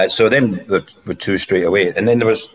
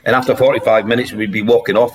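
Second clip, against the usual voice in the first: usual speaking voice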